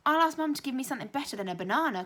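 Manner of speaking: loud, fast, eager outburst; cried out. A narrator's reading. Boy promising the new classmate a better snack, loud and eager. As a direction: blurt it out, bursting with excitement. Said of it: "cried out Michael".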